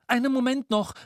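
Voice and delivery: put-on high, urgent boy's call. A narrator's reading. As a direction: call out high to hold things up. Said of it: "rief Jamiro". (hoch rufen, wie ein Junge)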